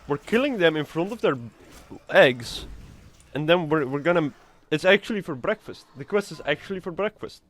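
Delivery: normal talking voice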